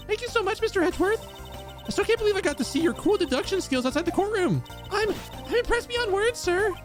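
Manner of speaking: put-on bright high-pitched young woman; high girly voice. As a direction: squeak it out, eager and star-struck. Streamer voices girl character.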